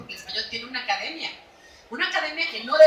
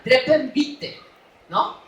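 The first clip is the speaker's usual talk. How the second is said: ordinary delivery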